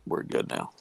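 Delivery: breathless after holding breath. Real, not acted. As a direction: let the air out, whispery. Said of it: "hold your breath".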